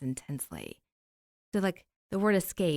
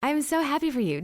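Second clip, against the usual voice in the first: excited; 8 semitones higher, 4 dB louder than usual. Real, not acted. Friend overjoyed at enlightenment.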